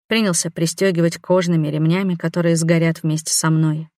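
ordinary delivery

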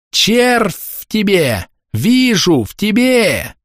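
very high, drawn-out denunciation; screeching. A narrator's reading. Woman behind a bush denounces Pavel, screeching. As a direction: shriek each accusation slowly and high. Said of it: "заверещала женщина вороньим пугалом".

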